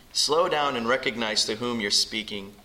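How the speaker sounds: ordinary delivery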